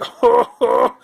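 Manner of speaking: high burst of laughter; loud laugh. Real, not acted. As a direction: crack up at own joke. Laugh after joke.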